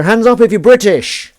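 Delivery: loud, high mock announcement; calling out to crowd. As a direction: shout it out over an imagined audience.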